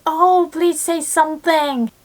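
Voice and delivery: high, excited, lightly mocking plea; eager friend request. Friends asking the teacher to say something, high and eager. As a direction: mimic people pestering a speaker of languages. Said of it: "they're like".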